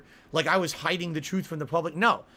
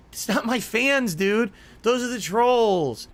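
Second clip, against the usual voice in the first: louder and higher, slow and insistent; heated slow protest; 6 semitones higher, 5 dB louder, slower than usual. Real, not acted. Rebutting John's claim that [name]'s fans attacked him, heated and slow. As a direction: hammer each word home, fed up.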